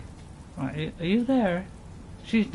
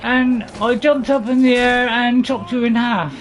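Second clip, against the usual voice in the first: raised, declaiming put-on heroine voice; Lady Eowyn; 4 semitones higher, 4 dB louder than usual. Lady Eowyn boasting of kills, high and declaimed. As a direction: boast loudly in a high character voice. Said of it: "My best Eowyn voice".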